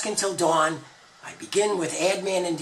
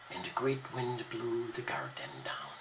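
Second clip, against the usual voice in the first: a hushed, soft telling; storyteller dropping to a whisper; whispered narration; 4 semitones lower, 12 dB quieter than usual. A narrator's reading. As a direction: whisper the storm's arrival.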